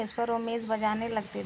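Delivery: ordinary delivery, nothing marked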